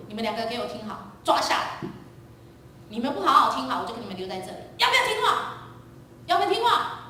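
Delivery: high, loud scolding shouts; furious father. Retold or imitated. Father pulled over on the highway yelling at his kids, furious. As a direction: blow up at the two kids.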